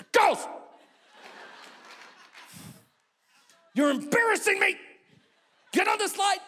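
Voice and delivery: dad yelling at daughters atop the slide; mock yell. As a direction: yell at the girls in frustration.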